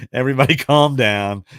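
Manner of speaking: laughing through the words. Real, not acted. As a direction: giggle it out. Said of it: "laughing at T-shirt".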